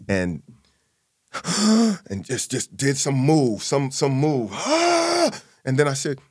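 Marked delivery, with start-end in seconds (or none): 1.3-2.0 sharp gasp. Retold or imitated. Startled by father's move. 4.6-5.4 high startled gasp; sudden high gasp of shock. Retold or imitated. Thrown to the floor.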